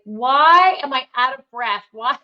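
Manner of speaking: loud, high mock outrage at a small hill; exasperated. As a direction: replay your breathless annoyance at yourself. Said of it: "I'm like".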